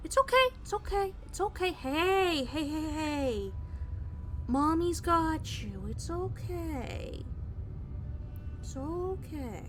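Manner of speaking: slow, soft comforting. Real, not acted. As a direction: hush a frightened pet. Comforting scared cat.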